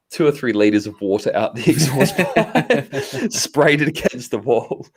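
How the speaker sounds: laughing through the words; chuckling as he recalls the spray. Real, not acted. Laughing while telling mishap.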